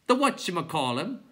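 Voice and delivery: clown creature voice. A narrator's reading.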